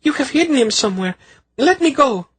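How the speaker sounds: very high, fast panicked pleading; struggling free, desperate; frantic accusation. A narrator's reading. Hysterical queen fights Garion.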